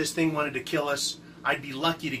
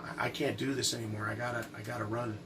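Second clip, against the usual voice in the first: soft, lowered voice, halting; quiet retold desperation; 4 semitones lower, 9 dB quieter than usual. Friend telling John he has to run, quiet desperation. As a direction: act out a friend at breaking point, hushed. Casey snaps, pleads to leave.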